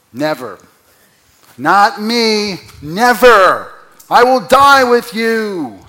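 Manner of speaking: high loud pledge: never, not me; disciples' bold vow. Retold or imitated. Preacher voicing the disciples' boast, loud and high. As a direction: shout the vow like a proud disciple. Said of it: "these are the guys who ... were saying".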